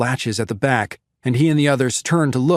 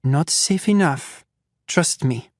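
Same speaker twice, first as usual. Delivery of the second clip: put-on French accent; 4 semitones higher, slower than usual. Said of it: "slightly French accent".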